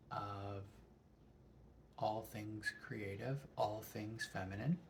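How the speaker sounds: ordinary talk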